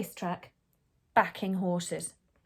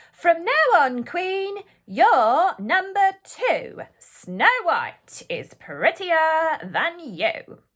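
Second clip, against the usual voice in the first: slow, higher, gloating pronouncement; mirror's verdict; 6 semitones higher, 7 dB louder, much slower than usual. A narrator's reading. The mirror telling the queen she is second, slow and gloating. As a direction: deliver the blow slowly and high. Mirror announces bad news.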